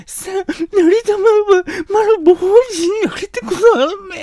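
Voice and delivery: high, fast, blubbering cry; a boy bawling at his mother, comically overdone; sobbing son. Retold or imitated. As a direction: wail it out through hammed-up sobs. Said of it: "非常にしゃくり上げて、おいおいと泣いて".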